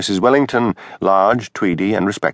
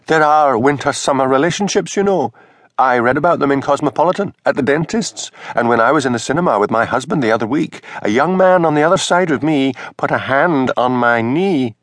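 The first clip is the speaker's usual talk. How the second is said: higher, indignant minister's wife; 6 semitones higher than usual. A narrator's reading. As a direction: defend yourself, offended and higher. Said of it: "Her face flamed".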